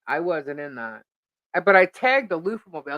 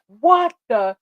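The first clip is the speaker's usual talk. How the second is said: shocked; 4 semitones higher, 8 dB louder than usual. Retold or imitated. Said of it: "I'm like".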